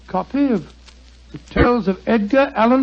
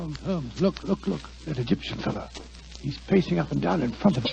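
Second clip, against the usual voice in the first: much softer, hurried, hushed warning; urgent hushed warning; 3 semitones lower, 10 dB quieter than usual. Acted. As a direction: keep your voice down, don't be heard. Hiding in dark alley, watching.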